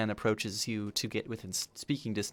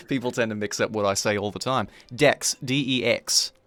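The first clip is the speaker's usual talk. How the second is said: put-on Australian accent; 8 dB louder than usual. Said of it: "My accent's not quite traditionally".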